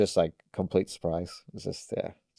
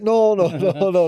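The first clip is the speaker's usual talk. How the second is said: high, loud string of protests; frantically refuse, hamming it up; panicked mock refusal; 9 semitones higher, 7 dB louder than usual. Skater retelling refusal to visit shop, mock panicked protest.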